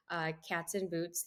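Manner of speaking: usual speaking voice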